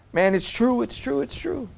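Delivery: soft, high mock-tearful admission; imitating tearful dad. Crying dad admits it to his son, imitated tearfulness. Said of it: "my dad starts crying".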